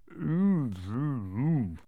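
wordless mumbled mm-mm-mm; closed-mouth humming mumble; mouth-full mumble. A narrator's reading. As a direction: mumble through a swallowed mouthful. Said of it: "mumbled the Earl".

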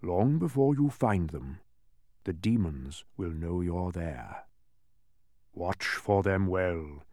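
slow, grave, spaced-out warning; emphatic warning. A narrator's reading. Mathematician warning travelers of demons, grave and emphatic. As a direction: weigh each word slowly in concern. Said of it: "he emphasized".

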